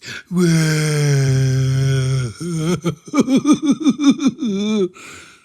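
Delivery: long, high, loud demo laugh; teacher showing a disruptive loud laugh on Zoom; loud cackling laugh. Shown as a demonstration. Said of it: "unmute yourself and do this".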